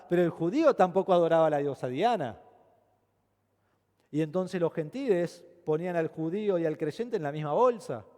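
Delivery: usual speaking voice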